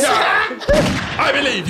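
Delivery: high, loud excited yell; excited shout. Real, not acted. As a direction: yell it with hype. Hosts hype each other.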